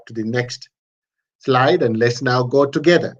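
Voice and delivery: normal talking voice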